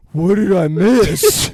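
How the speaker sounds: loud, slow, odd voice; mock Eeyore. Eeyore walking in, slow and loud. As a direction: drag it out like a dopey donkey. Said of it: "he's like".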